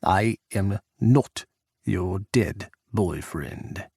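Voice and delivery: slow, lightly Russian-accented mocking; a touch of Russian accent, very slow; Russian president mocking Jack in a Russian accent. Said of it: "Pukov's rough accent grated".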